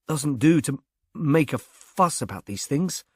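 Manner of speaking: plain reading voice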